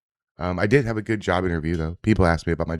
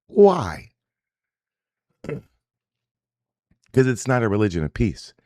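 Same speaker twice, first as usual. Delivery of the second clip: normal talking voice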